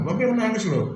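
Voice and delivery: suara anak kecil yang tinggi dan merengek; anak mengancam menangis. Retold or imitated. Child threatening to cry. (anak mengancam akan menangis agar dibelikan)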